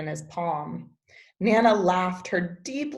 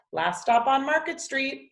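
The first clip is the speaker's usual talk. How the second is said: called out; 7 semitones higher than usual. Acted. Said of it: "Mister Dennis called".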